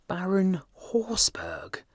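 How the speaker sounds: breathless, high and slow; stunned whisper. A narrator's reading. Hughie realizing who the beggar was, stunned. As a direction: whisper the name in shock. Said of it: "cried Hughie".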